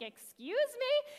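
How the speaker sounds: high, slow "excuse me?" in mock offense; offended retort. The listener answers a stranger's "hey, woman" with mock offense. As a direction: act like a stranger just insulted you. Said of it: "you're gonna be like".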